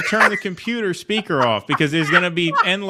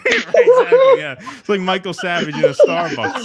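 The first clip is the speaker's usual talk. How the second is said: cracking up while agreeing; laughing; 3 semitones higher, 7 dB louder than usual. Real, not acted. Laughing at the Michael Savage joke.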